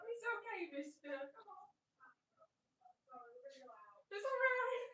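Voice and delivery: soft high voice calming a scared cat; gentle, cooing reassurance; soothing pet voice. Real, not acted.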